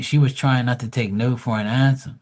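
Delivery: ordinary talk, nothing marked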